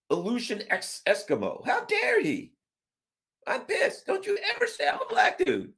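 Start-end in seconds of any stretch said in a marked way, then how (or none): 1.6-5.7 hammed-up squeaky fury; mock outrage. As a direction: fake being furious over nothing.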